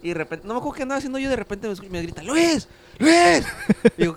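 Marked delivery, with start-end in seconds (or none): 2.2-3.4 high, loud yell of a name across the house; calling out. Retold or imitated. Older brother calling Luis from the kitchen, yelling. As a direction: shout for him from another room.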